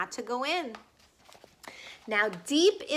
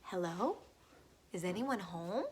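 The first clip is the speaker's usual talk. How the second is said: soft, lower hesitant hello; timid call; 6 semitones lower, 12 dB quieter than usual. A narrator's reading. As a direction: call shyly into the empty house. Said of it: "and said".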